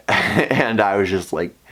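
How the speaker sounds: ordinary delivery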